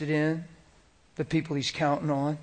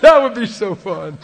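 louder, higher and slow, laughing; 6 semitones higher, 9 dB louder than usual. Real, not acted. Preacher laughing to the crowd about the idea. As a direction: relish it through laughter.

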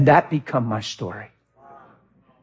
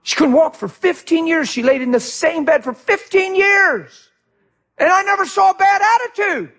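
full-force preaching; 12 semitones higher than usual. Real, not acted.

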